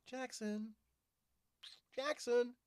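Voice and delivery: high sing-song call of a pet's name; calling the cat. Real, not acted. Owner calling her cat to come, high call. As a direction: call him in like a pet owner.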